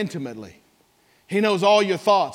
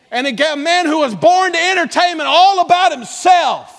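much higher, louder fervent preaching; preaching shout; 9 semitones higher, 6 dB louder than usual. Real, not acted. Preacher to church about [name], fervent shout. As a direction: lift it high and loud.

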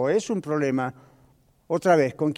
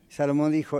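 usual speaking voice

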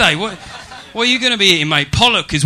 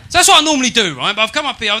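ordinary talk, nothing marked

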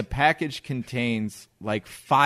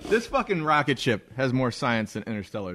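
ordinary delivery

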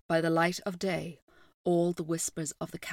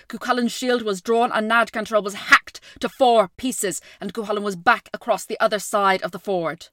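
intense battle narration; 2 semitones higher, 7 dB louder than usual. A narrator's reading. Battle narration builds loud.

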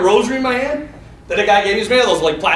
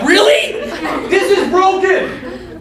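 incredulous outburst; 11 semitones higher, slower than usual. Retold or imitated. Patient incredulous about needing an x-ray.

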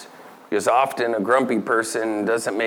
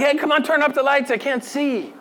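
higher, quick, hammed-up whine about the dim lights; mock complaint; 12 semitones higher than usual. Preacher voices someone in a dim room, mock whine. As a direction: play a fussy person squinting in the dark. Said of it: "you're like".

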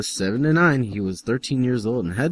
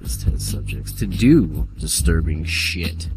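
hammed-up deep narrator; lightly voiced ominous reader; dramatic reading voice; 5 semitones lower, 4 dB louder than usual. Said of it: "Stop reading like that".

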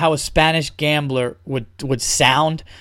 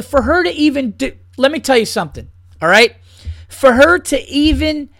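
rip into it, genuinely pissed off; angry rant; 6 semitones higher than usual. Real, not acted. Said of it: "this pisses me off".

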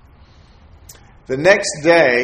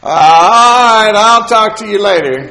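laughing; 9 semitones higher, 10 dB louder, slower than usual. Retold or imitated. Stepdad ends call laughing.

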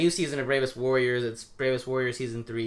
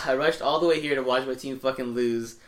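usual speaking voice

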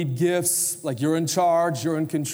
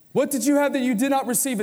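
Paul daring the church, higher and quicker; challenging; 9 semitones higher, faster than usual. Acted. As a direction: throw the question down like a gauntlet. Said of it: "It's a challenge".

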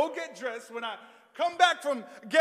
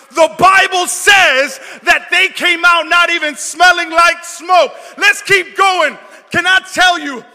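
loud, building revival shout; full-force preaching; 5 semitones higher, 13 dB louder than usual. Real, not acted. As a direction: build to a roaring sermon climax.

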